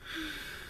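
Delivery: long soft contented sigh; blissful sigh. Retold or imitated. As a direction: melt into a happy sigh. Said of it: "I was just like".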